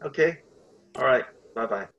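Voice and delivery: usual speaking voice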